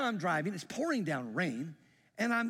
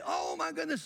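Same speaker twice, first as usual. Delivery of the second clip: shocked gasp; 12 semitones higher than usual. Retold or imitated. Church member hears the news.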